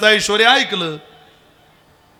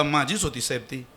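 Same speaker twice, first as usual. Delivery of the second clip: meek reply; 6 semitones lower, 11 dB quieter than usual. Retold or imitated. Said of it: "तो म्हटला".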